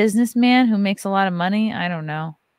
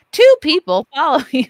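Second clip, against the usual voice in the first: mock analytics voice; 6 semitones higher, 8 dB louder than usual. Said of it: "they're like".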